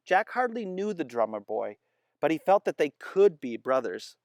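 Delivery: plain reading voice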